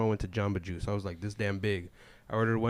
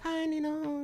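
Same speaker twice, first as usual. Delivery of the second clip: very high sweet fawning voice; girly coworker voice; slower than usual. Retold or imitated. Goddaughter sweetly greeting her godfather, very high. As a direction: gush it way up high like a little girl.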